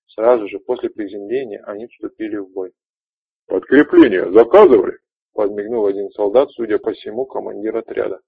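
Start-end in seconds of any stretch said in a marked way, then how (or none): 3.5-5.0 cocky shout. Acted. Soldier quips arriving in battle.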